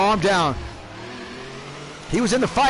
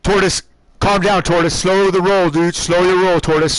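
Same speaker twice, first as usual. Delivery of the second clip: loud, firm radio orders; stern team owner; 5 dB louder than usual. Real, not acted. Team owner telling Tortoise to calm down, stern. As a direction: order the driver firmly, voice raised. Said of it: "I gotta be a team owner here".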